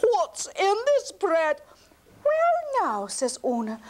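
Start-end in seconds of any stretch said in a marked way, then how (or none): 0.0-1.6 slow, much higher, louder indignant question; a giant demanding answers through broken teeth; outraged demand. Acted. 2.2-3.1 much higher, louder feigned innocence; mock innocent. Una answers innocently.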